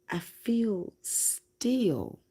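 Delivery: high and slow, drawn out and serene; slow, still voice. Retold or imitated. Telling everyone who asked, slow and serene. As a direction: float the words out slowly, calm and detached. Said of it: "And I just kept saying".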